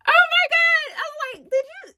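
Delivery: real delighted shock at his sacrifice; amazed. Real, not acted. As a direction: squeal it like the news just hit. Learns he only had burger money.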